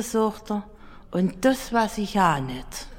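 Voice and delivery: ordinary talk, nothing marked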